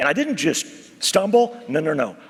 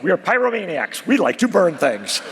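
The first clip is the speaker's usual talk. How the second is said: usual speaking voice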